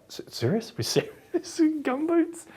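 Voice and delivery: squeaky incredulous laugh; laughing disbelief. Retold or imitated. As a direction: shoot up high in amused shock. Disbelief at director's yes.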